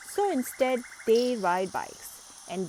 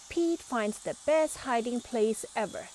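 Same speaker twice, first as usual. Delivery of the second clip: ordinary delivery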